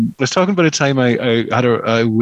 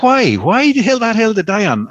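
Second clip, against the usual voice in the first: indignant quote; 8 semitones higher than usual. Retold or imitated. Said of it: "she was like".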